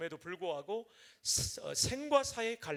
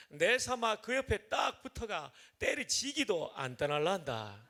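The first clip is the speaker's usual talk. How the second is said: louder, hearty Gyeongsang dialect reading; 3 dB louder than usual. Shown as a demonstration. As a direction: read the psalm in a broad Gyeongsang dialect, some lines slowed. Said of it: "충청도 사람이지만 경상도 버전입니다". (경상도 사투리로 힘차게 읽기)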